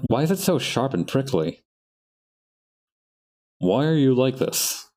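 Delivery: usual speaking voice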